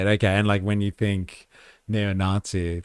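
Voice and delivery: ordinary delivery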